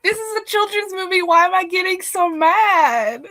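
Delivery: laugh-cry your own indignation; mock outrage. Retelling her fury at a kids' movie, laughing. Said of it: "I was so mad".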